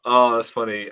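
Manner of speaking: ordinary talk, nothing marked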